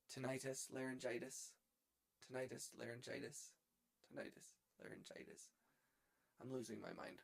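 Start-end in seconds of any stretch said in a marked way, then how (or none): 0.1-5.5 soft muttering, growing fainter; fading repetition. Real, not acted. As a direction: mutter it to yourself, trailing into near silence. Repeating to himself, fading.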